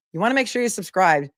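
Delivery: usual speaking voice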